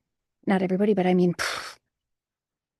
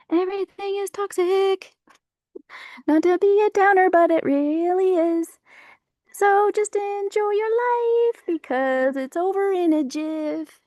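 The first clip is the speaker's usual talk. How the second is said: much higher, put-on squeaky voice for a mock warning; 10 semitones higher than usual. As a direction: squeak it out like a silly cartoon preacher. Mock high voice rant.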